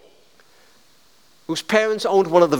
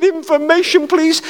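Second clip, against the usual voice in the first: put-on higher, louder little boy calling; a nine-year-old asking for help; young boy on the phone; small child; 9 semitones higher, 6 dB louder than usual. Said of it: "when I was nine years old".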